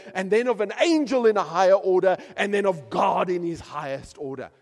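rising sermon build with a loud run; preaching. Acted. Preacher to congregation, building preaching. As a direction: preach it, climbing through the list.

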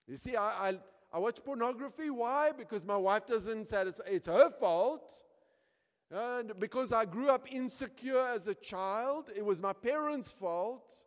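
high, loud self-justifying excuses; hammed-up protest that others are at fault; indignant blame-shifting. Acts sinner loudly blaming wife and parents.

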